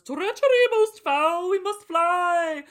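put-on high, loud, slow theatrical old-fashioned proclamation; mock Old English. Possessed Rapscale speaking in Old English, hammed-up theatrical proclamation. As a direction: declaim like a possessed medieval herald. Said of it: "starts talking in Old English".

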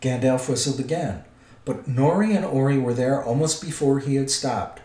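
plain reading voice

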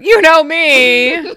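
slow, high, laughing mimicry; mocking echo. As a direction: drag it out teasingly.

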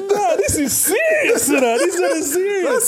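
high, drawn-out cry of mock alarm. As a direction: stretch it out high, half laughing. Amused reaction to the rival's taunt.

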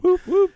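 high, loud double whoop. Real, not acted. Jumps in before a new topic.

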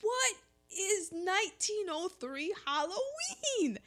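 high, slowed disbelief; mock bewildered question; baffled. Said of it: "you're just like".

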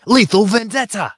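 high, hyped announcer call. Pretend. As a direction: belt it like a ring announcer. Announcer-style interjection.

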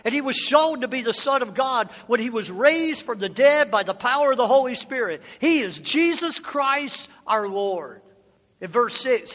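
usual speaking voice

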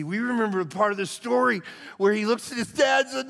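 normal talking voice

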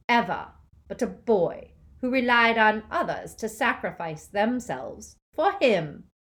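loud, high, sneering dark lord; mock the defeated crowd with loud scorn; Voldemort gloating. A narrator's reading. Dark lord taunting the defenders, loud sneer.

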